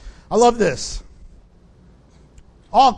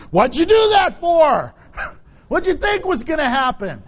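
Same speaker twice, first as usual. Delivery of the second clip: mock scolding; 6 semitones higher, 4 dB louder than usual. Pastor scolding pregnant congregant.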